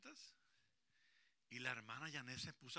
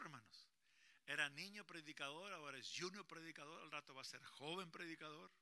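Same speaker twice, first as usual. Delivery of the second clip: ordinary talk, nothing marked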